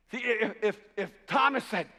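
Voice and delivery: ordinary talk, nothing marked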